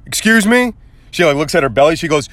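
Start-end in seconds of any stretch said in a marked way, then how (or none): 0.0-0.7 high, rising mock shock; pitched-up incredulous query; taken aback. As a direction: imitate an offended sister's startled question. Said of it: "this surprised eye look".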